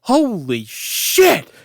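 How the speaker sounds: slow, high drawn-out cry of shock; jolted awake and appalled; mock startled mom blurting it out; startled mother. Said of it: "you'd hear my mother going".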